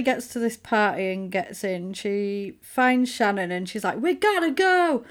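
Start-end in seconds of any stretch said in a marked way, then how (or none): 4.0-5.0 a much higher, pressing call to leave; urgent. Retold or imitated. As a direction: hurry her sister out of the party. Alex urging sister to flee.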